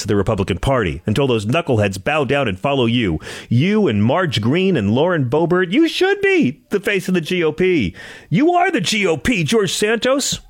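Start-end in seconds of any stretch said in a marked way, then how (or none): 3.5-10.4 higher and higher, building to a peak; rising hype. Acted. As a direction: hype him up like a rally. Hyped rally crescendo.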